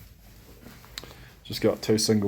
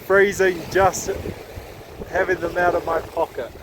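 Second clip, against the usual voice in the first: raised voice over the wind; shouting over wind; 9 semitones higher, 5 dB louder than usual. Real, not acted. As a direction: call out above the gusts. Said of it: "I don't know if you can hear me over the wind".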